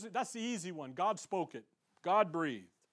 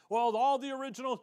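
higher, louder mocking quote of a know-it-all; 5 semitones higher, 6 dB louder, faster than usual. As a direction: parrot the crowd's smug line. Mocking what people say.

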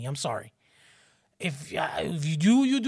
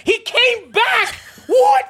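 high, loud shouted disbelief; mock mind-blown; 8 dB louder, much slower than usual. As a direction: shriek it back like kids whose minds are blown. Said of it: "Their minds are blown".

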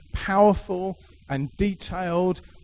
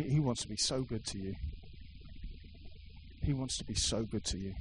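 1 soft repetition; 3 semitones lower, 10 dB quieter than usual. Real, not acted. Preacher repeats softly.

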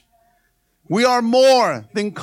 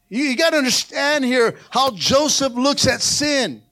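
ordinary delivery

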